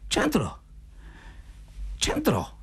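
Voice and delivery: promessa esitante, acuta e sommessa; balbettio. A narrator's reading. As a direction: cedi balbettando, in alto e con una pausa. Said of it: "balbettò Zotov". (assenso incerto a voce acuta)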